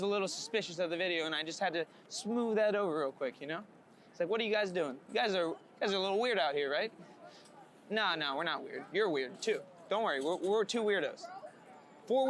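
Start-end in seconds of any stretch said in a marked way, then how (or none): none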